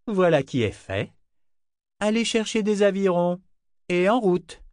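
high, satisfied declaration; self-satisfied. A narrator's reading. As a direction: give orders, thoroughly pleased with oneself. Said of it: "déclara-t-il avec satisfaction".

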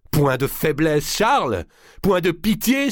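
raillerie criée, haute et forte; cri de fureur. Acted. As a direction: hurler de rage en singeant. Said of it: "s'écria-t-elle". (fureur moqueuse et aiguë)

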